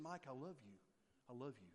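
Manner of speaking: put-on calm a fussing child with love; gentle reassurance. God speaking softly to Mike as he cries, tender. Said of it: "he's saying".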